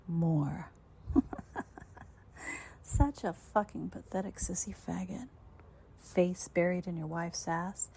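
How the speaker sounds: contemptuous whisper. Acted. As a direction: whisper the insult with scorn. Mistress insulting the listener.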